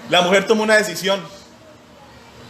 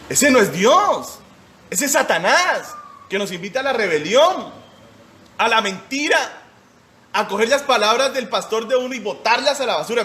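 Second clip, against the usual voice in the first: fervent preaching; 5 semitones higher than usual. Real, not acted. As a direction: preach it out against Satan. Preacher denouncing Satan.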